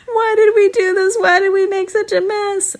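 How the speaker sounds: put-on high whimpering kid voices. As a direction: whine it like sorry seven-year-olds. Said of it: "started kind of whimpering".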